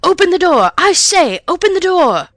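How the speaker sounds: loud high angry shout; man shouting. A narrator's reading. Angry man outside shouts at the locked door. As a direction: bellow it through the door. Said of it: "it was shouting".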